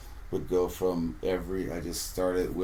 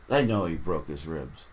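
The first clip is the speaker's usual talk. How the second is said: ordinary delivery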